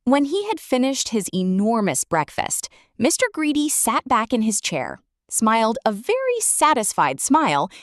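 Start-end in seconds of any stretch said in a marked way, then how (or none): none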